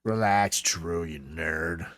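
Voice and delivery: low, soft, slow teasing; dismissive drawl. Real, not acted. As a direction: drag it out, mocking. Teasing the doctor.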